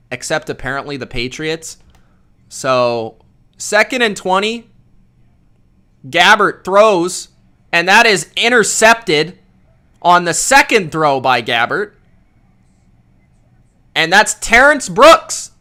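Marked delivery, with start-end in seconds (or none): 3.6-15.5 punchy, raised commentator call, pausing between phrases; live play-by-play. Real, not acted. Streamer calls an interception for viewers, excited play-by-play. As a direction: call the interception as it unfolds, loud on key words.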